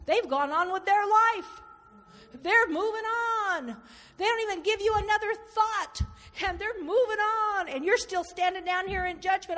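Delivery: high, loud, insistent preaching; full-force preaching. Acted. Preacher pressing the congregation about those who moved on, loud and insistent. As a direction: drive it home from the pulpit.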